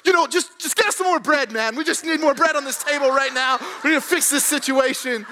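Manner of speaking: hammed-up desperate begging; freaking out. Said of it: "freaking out, and I'm just like".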